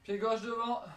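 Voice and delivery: high, loud workout call; coach command. Real, not acted. Coach calling foot position.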